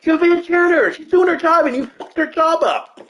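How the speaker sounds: high-pitched furious tirade at the careless cleric; flipping out. Retold or imitated. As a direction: squeal it out in frustrated disbelief. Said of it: "I was flipping out".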